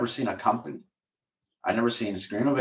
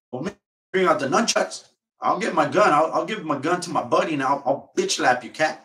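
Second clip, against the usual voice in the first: higher, hammed-up tough-guy threat; mock gangster; 7 semitones higher than usual. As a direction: play him going gangster for laughs. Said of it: "he goes gangster on the cat".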